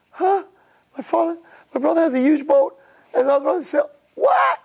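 high, bewildered little voice; a confused kid who can't believe it; baffled son. Acted. Youngest son baffled by kayak.

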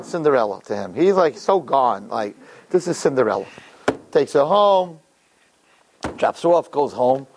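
ordinary talk, nothing marked

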